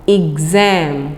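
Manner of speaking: "एग्जाम" slow and low, with a clear gz in the middle; correct pronunciation. Shown as a demonstration. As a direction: model "एग्जाम" slowly, stressing the gz sound. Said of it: "सही pronunciation पे गौर कीजिए".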